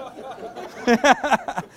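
short amused laugh; real laugh. Real, not acted. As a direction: laugh at your own joke. Laughs at own joke.

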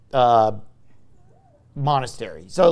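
ordinary talk

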